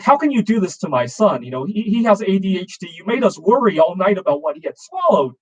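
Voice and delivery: worked-up retelling of an accusing mom; upset mother. Retold or imitated. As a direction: imitate an upset parent confronting a neighbor. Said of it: "she got very upset".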